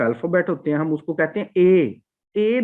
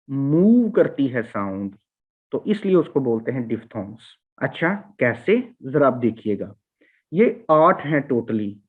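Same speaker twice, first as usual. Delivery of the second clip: ordinary delivery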